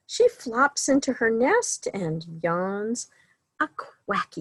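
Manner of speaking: lively, sing-song picture-book reading; reading to children. A narrator's reading. Librarian reading a bedtime story to children, sing-song. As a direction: read the bedtime book to the little ones.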